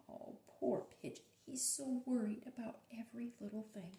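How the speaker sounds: fast hushed whisper. Real, not acted. Teacher whispering to the class about the worried pigeon. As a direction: whisper it with sympathy.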